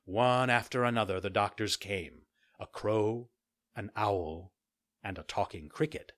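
usual speaking voice

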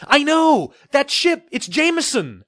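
high, loud rush of words; blurted. Acted. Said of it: "I blurted".

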